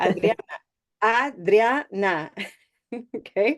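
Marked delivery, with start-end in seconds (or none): 0.0-2.3 Adriana em três sílabas, com a espanhol aberto; Spanish Adriana. Shown as a demonstration. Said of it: "in Spanish, Adriana is three syllables".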